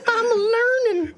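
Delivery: high, slow mock-crying wail; drawn-out whiny toddler cry; toddler impression. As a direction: wail it like a distressed little kid. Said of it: "my great impression of the toddler".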